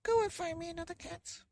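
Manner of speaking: put-on very high, bossy old woman; old woman ordering. Old woman ordering her husband, very high voice. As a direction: demand it in a squeaky voice. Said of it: "she said to the very old man".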